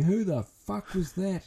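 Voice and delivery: high, slow incredulous "who the fuck"; mock bewildered shout. Other shooters asking who hit their target, high and baffled. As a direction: play a baffled shooter across the range.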